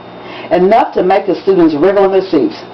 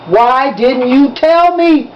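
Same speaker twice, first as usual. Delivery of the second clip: drawn-out, heavy demand; slow demand; 5 semitones higher, much slower than usual. A narrator's reading. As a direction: drag each word out, accusing. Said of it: "he demanded".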